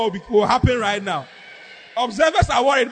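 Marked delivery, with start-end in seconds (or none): none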